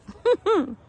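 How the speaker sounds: sudden high laugh; high burst of laughter. Real, not acted. Psychic laughing at the chant she repeated, high and bright. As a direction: let out a quick high laugh.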